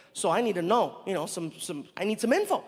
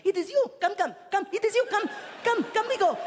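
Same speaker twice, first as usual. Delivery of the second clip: excited Saudi man; 13 semitones higher than usual. Retold or imitated. Excited greeter with a sign calls the comic, high and eager. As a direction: beckon him over, thrilled. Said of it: "He's like".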